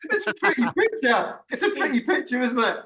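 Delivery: high, giggly teasing; mock it through real laughter; laughing mockery. Real, not acted. Laughing mockery of film's looks.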